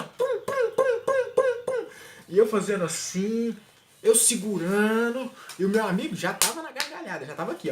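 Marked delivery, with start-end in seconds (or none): 0.0-1.9 high squeaky pop noises; rapid eye pop sounds. Retold or imitated. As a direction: squeak each pop fast. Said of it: "assim, ó". (sons de pum agudos e rápidos)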